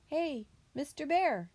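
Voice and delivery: high, friendly storybook call; young possum calling. A narrator's reading. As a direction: call over to the bear in a little voice. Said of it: "he called".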